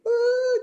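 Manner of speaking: a sudden high, loud groan of exasperation; exasperated uh. Retold or imitated. Wife groaning at her husband's provocations, sharp high exasperated uh. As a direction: let out a sharp, fed-up uh at the husband.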